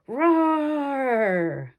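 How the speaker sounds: roar with feeling. Acted. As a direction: give it everything for the talent scout. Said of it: "with a little more feeling".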